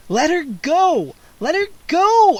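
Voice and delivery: loud high scream, rising to a shriek. A narrator's reading. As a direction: scream at the vampire to stop. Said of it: "I screamed".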